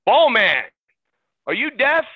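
high, loud mock yell, rising at the end; retold yell. Player yelling at the ball boy who ignores him, retold yell. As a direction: imitate an impatient player yelling for attention. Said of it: "the way he yelled it".

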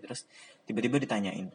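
usual speaking voice

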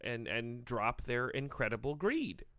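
low, slow official voice; Gary Bettman. Acted. Commissioner blaming the players in an interview, low and slow. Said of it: "N H L Commissioner Gary Bettman".

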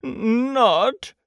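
high, hesitant stammer; mildly anxious. A narrator's reading. Pooh hopes his disguise works, mildly anxious. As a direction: falter on the first word, pitched high. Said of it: "said Pooh anxiously".

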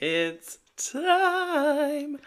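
high, drawn-out announcer call; dramatic announcer. Retold or imitated. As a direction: announce it like a show host. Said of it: "It's time for Julia to sing".

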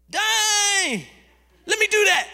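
high, loud excited burst; eager Peter. Retold or imitated. Peter eagerly asking to walk on water like Jesus. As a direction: jump in like Peter wanting to try.

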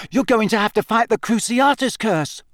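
high, anxious warning; frightened. A narrator's reading. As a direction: dread rising in his voice. Neville scared.

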